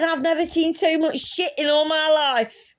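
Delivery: high laughing shout; screaming while laughing. Retold or imitated. Yelling to the whole cinema about the toilets, high and laughing. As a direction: shriek it through laughter. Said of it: "I'm screaming this whilst I'm still laughing".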